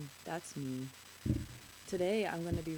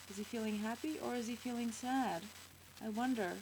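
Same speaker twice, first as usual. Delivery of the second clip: plain reading voice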